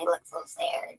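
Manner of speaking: breathy mock-sad whisper; hushed pity. Real, not acted. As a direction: whisper it like a pitying aside. Mocking whisper at sad face.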